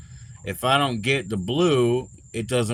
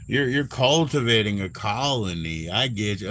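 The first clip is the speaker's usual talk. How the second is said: usual speaking voice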